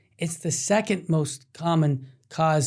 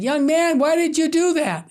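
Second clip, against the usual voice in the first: lightly voiced old woman, raised pitch; a higher, curious old lady's question; elderly lady asking; 9 semitones higher than usual. Elderly woman questioning the young man, lightly voiced higher. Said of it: "the woman said".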